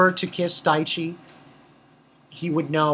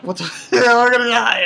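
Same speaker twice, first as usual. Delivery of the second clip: loud mock panic with a laugh; 4 semitones higher, 6 dB louder, faster than usual. Crew panicking as the ship comes down, loud mock panic. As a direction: freak out, half laughing. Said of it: "they're all freaking out".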